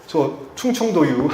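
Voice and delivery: slow, drawn-out Chungcheong drawl, half joking; playful Chungcheong dialect. Pretend. Pastor teasing the congregation about his slow Chungcheong home dialect. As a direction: lean into the Chungcheong dialect for a laugh. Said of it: "저 충청도 사람이에요".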